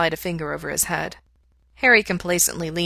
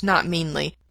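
usual speaking voice

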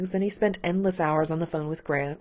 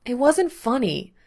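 disgruntled; 6 semitones higher, 5 dB louder than usual. A narrator's reading. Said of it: "still feeling disgruntled".

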